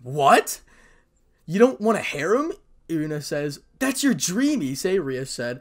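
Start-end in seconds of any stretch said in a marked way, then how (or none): 0.0-2.5 loud, high-pitched disbelief; raised voice, incredulous question; shocked outcry. A narrator's reading. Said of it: "burst through the door and said in unison". 3.8-5.0 high, loud disbelief; raised, pleading outburst; shocked protest. A narrator's reading. Shocked protest at his renunciation.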